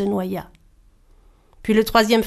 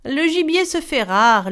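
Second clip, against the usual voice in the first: higher, offhand excuse; weary retort; 6 semitones higher, 4 dB louder than usual. Acted. Lazy husband retorting to his wife, offhand. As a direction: toss off the same old excuse. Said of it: "rétorquait-il inlassablement".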